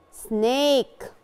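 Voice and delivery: very slow سنيك, "ay" stretched out; سنيك drawn out as "snaaayk"; long vowel in سنيك. Shown as a demonstration. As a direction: hold the "ay" in سنيك. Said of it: "الفرق بين الشورت فاول واللونج فاول".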